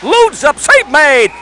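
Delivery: loud, booming call of the save; shouted save call. Real, not acted. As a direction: bellow it over the arena noise. Big save, announcer yells.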